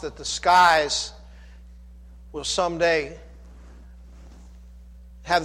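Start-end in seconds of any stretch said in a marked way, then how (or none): none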